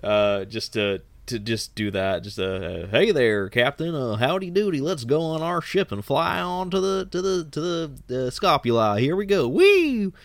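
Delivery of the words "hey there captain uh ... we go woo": Texan cowboy Alex. Retold or imitated. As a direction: play the cheerful Martian cowboy pilot, all howdy. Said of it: "whoever they cast to play Alex".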